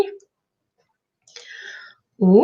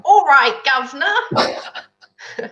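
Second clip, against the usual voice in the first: higher, drawn-out mock London accent; 5 semitones higher, slower than usual. Shown as a demonstration. Co-host trying an accent on request, hammed-up London accent. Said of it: "a London accent".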